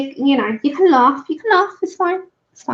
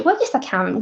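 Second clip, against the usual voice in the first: normal talking voice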